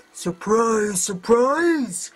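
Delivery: spring the trap with a sneer; mocking Skelegard. A narrator's reading. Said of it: "said one of the Skelegards".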